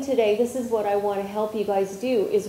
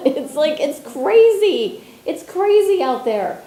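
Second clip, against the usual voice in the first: excited exclaiming; 6 semitones higher, 7 dB louder than usual. Real, not acted. Teacher to workshop group, excitedly stressing how crazy it is. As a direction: voice jumps much higher and louder, stretching crazy.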